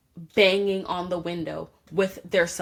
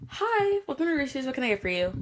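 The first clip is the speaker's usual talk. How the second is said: higher, sugary mock service voice, like you don't know why he's here; fake-polite cashier; 6 semitones higher than usual. Cashier playing dumb to a rude delivery driver, mock sweet service voice. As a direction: play dumb with an overly friendly welcome. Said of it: "I'm playing stupid".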